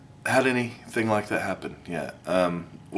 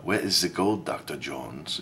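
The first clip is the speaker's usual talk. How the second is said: put-on Nazi villain's German accent; 2 semitones lower, 4 dB quieter than usual. Mock Nazi questioning Doctor Jones, in a German accent. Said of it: "kinda like the Nazi thing".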